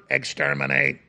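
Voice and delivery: low monotone mechanical chant; Dalek. Acted. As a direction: repeat it evenly and softly like a killer robot. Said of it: "it's the Daleks from Doctor Who".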